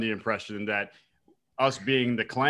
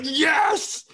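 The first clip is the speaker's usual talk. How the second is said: high, loud cheer; excited yell; 7 dB louder than usual. Retold or imitated. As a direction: shout it like you just saw a headshot. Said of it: "I was like".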